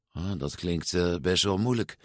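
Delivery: soft, downcast hesitation; somber. A narrator's reading. Luca doubts the tough race, somber. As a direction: say it gloomily under your breath. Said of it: "zei Luca somber".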